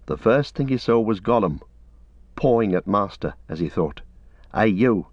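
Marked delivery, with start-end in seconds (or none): none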